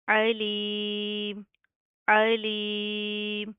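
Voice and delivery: अलीम held very long with a clear opening ain; slow isolated recitation; separate word demo. Shown as a demonstration. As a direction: say अलीम alone, slowly. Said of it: "separate भी पढ़ के दिखाती हूँ".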